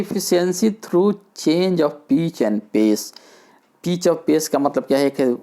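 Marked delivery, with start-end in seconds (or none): 0.0-3.0 slow heading. Shown as a demonstration. Reads point title slowly.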